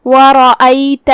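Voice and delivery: slow ورأیت with a thick, heavy ر. Shown as a demonstration. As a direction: darken the ر in ورأیت and sound the hamza clearly. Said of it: "را کو بھاری آواز میں پڑھیں گے".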